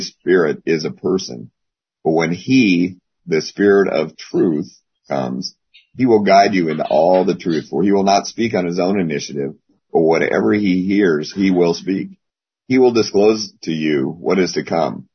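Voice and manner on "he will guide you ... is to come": ordinary delivery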